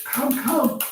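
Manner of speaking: squealing high, then cooing come; high flirty call. Retold or imitated. Six other women calling the single man over, high and flirty. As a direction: lure him in with a high squeal. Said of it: "six other ones like".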